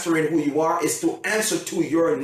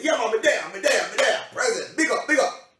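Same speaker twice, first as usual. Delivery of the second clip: higher-pitched Jamaican patois reply; Jamaican accent; 6 semitones higher than usual. Acted. Donovan answering for his present, in Jamaican accent. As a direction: answer eagerly with a Jamaican lilt. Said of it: "Donovan is a Jamaican".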